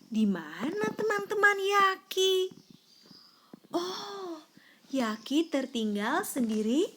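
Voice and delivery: ordinary delivery, nothing marked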